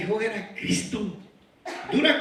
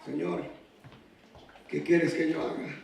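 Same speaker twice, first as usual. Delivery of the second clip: hushed plea; 6 semitones lower, 6 dB quieter than usual. Retold or imitated. Saulo's surrender retold softly.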